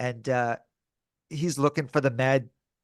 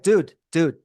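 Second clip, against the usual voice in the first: louder, higher imitated objection; raised-voice retold "hold on"; retold protest; 4 semitones higher, 7 dB louder than usual. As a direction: act out cutting someone off in protest. Retold protest to stop him.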